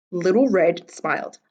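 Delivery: usual speaking voice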